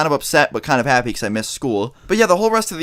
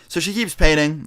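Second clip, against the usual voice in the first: normal talking voice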